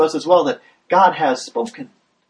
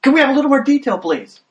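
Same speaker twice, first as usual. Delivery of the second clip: pleading call; 7 semitones higher, faster than usual. Pretend. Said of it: "people are calling out".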